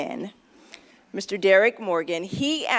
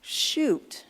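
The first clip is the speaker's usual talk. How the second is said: dismayed oh shoot; 3 semitones higher than usual. Retold or imitated. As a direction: retell the moment you realise you forgot. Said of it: "and I said".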